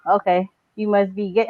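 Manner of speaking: usual speaking voice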